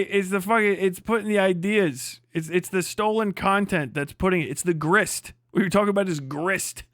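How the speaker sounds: ordinary talk, nothing marked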